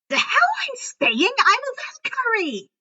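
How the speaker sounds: high, loud protesting yell. A narrator's reading. Eyre refusing to stay behind, yelling in protest. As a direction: shout back in outrage at being left. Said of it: "Eyre yelled in protest".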